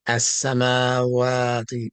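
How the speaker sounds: "As-samawati" slowly, with long held a vowels; drawn-out medda in "As-samawati"; elongated vowels. Shown as a demonstration. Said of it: "it's not pronounced like that".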